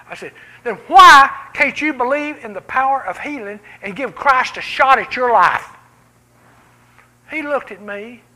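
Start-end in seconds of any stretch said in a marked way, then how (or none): 0.6-5.7 loud, earnest push; urging challenge. Retold or imitated. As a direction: dare him to believe. Retold challenge to hospital patient.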